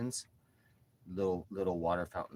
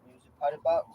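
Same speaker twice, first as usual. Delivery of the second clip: higher, louder cooing to the cat; pet talk; 7 semitones higher, 8 dB louder than usual. Real, not acted.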